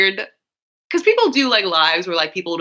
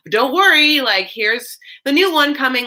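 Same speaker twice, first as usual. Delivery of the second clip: mock network promo; 7 semitones higher, 7 dB louder than usual.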